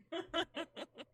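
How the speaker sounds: high, quick chuckle; giggle. Real, not acted. Player about to take his turn, giggling. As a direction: giggle lightly in a high pitch.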